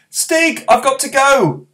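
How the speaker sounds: put-on high, eager boy's voice; young boy calling to his friend; young boy Barney. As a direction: speak up as a small boy.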